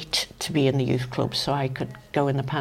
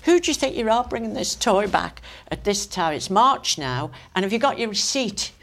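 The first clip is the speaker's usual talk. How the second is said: imitating an indignant shop worker, raised pitch; retold scolding; 5 semitones higher than usual. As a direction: tell off a customer in mock indignation. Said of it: "she'd said to her".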